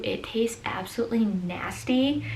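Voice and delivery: hushed aside, all breath; whispering. Real, not acted. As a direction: whisper it like a confession. Confiding how bad the paste tastes.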